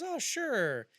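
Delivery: high, drawn-out agreeable voice; mock-sweet high acceptance; imitated woman accepting. Acting woman accepting dinner invitation.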